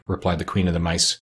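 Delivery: ordinary delivery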